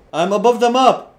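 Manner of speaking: loud, high yell of disbelief; high, incredulous holler; astonished shout. Real, not acted. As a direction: shout it in amazement. Glitched above the map.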